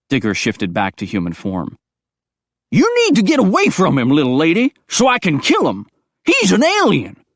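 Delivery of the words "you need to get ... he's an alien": put-on high, loud, twangy old coot; crazy old hillbilly. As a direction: paranoid old mountain man, all fired up. Digger, old backwoods man.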